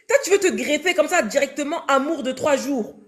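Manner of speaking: ordinary talk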